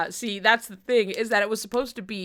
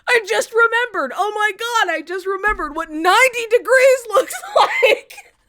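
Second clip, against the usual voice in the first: higher, louder, laughing through it; laughing retelling; 10 semitones higher, 7 dB louder than usual. Retold or imitated. Repeating Canary's line to the group, laughing. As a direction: relive it cracking up.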